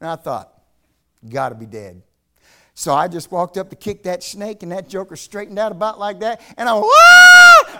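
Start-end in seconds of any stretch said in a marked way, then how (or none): 1.3-2.0 low, quiet aside to self; retold private thought. Husband eyeing a snake in the road, low quiet thought. Quoting his own unworried thought in anecdote. 6.9-7.8 huge high-pitched scream of alarm; startled yell. Retold or imitated. Man jumping back from a live snake, startled high yell.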